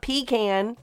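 PECAN as PEE-can, like a pee can; wrong pronunciation. Shown as a demonstration. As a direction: say "pecan" with a long ee, PEE-kan. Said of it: "It's not".

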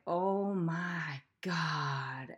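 slow, drawn-out, stunned Oh my God; awed realization. Retold or imitated. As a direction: let it dawn on you very slowly. Realization during the ritual.

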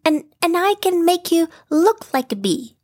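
a small eager piglet offering help. Acted. Piglet voiced in story.

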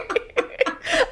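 high airy giggle; breathy giggle. Real, not acted. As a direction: silent breathy laugh. Laughing at the memory.

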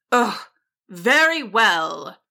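slow, grudging wizard huff; huffy. A narrator's reading. Wizard gives in to the dragon, huffy. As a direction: give in with a drawn-out huff. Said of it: "he huffed".

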